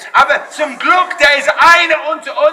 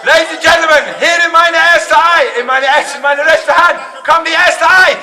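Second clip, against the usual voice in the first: raised showman announcer voice; announcing the stunt; 4 semitones higher, 4 dB louder than usual. Real, not acted. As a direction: build the big moment for the crowd.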